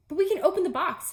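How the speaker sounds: high, loud, fast eager protest. Acted. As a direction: blurt it out eagerly.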